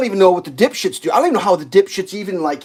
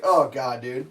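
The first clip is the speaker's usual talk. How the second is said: ordinary talk, nothing marked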